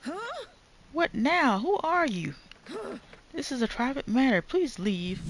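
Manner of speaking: high, cold dismissal; haughty old woman. Acted. Old woman ordering an intruder to leave, high and cold. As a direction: shoo the stranger off like a stiff matriarch.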